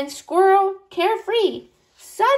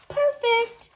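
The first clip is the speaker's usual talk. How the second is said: chirpy high reply; 10 semitones higher than usual. Acted. Bunny's cheerful reply.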